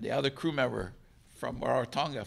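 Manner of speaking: ordinary delivery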